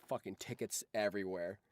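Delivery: normal talking voice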